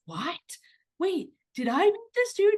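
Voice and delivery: high, breathy startled whisper; surprised. Retold or imitated. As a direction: gasp it out in disbelief. Mom reacting in retold story.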